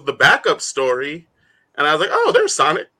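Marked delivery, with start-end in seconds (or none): 2.1-2.9 high-pitched mock discovery; light up as if finally spotting him; pleasant surprise. Retelling relief at spotting Sonic.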